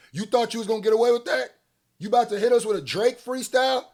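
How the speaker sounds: loud, high, comic outrage; incredulous challenge. Real, not acted. Calling out the rapper.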